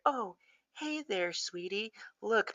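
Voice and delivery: possessed mom faking sweetness to her daughter; sweet mother voice. Retold or imitated. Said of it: "that mother kind of voice".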